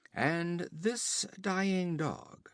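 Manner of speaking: slow, high mocking drawl; contemptuous sneer. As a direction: drag out the insult. Olgerd sneers at Conan.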